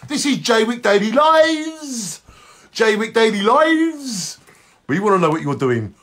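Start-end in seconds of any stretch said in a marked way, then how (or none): 0.4-4.4 "Lives" stretched long and high; show-name call. Shown as a demonstration. Host announcing the show, "Lives" drawn out high. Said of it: "when you say Jay Wick Daily Lives".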